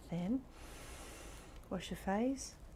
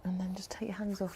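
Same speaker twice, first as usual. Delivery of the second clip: breathy whispered instruction; faster than usual. Acted. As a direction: whisper it gently. Whispered cue in meditation.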